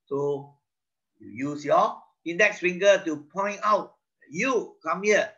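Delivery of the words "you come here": high, brisk commanding call; mock captain's summons. Shown as a demonstration. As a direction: order someone over, pitched up. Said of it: "This is my commanding finger".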